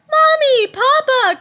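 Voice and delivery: put-on very high, loud child's cry; man calls to parents like a child, high; childlike cry. A narrator's reading. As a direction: call out like a small boy. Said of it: "cried Mister Krupp".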